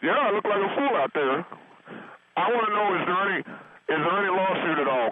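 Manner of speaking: loud, tearful phone rant; breaking down crying. Real, not acted. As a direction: wail it through tears. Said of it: "broke down and wept".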